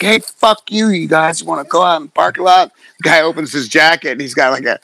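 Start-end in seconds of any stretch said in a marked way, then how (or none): 0.0-2.7 challenging taunt. Retold or imitated. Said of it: "we're like".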